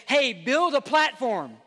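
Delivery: loud, high, insistent goading; pushy world's voice. Acted. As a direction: egg someone on to grab the limelight. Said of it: "In a world that says".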